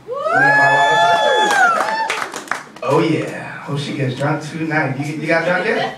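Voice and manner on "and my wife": high drawn-out voice. Acted. Drawn-out high sing-song.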